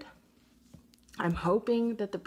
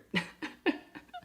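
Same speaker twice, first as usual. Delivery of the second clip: high breathy laugh; 13 semitones higher than usual. Real, not acted. Laughs at her own predictability.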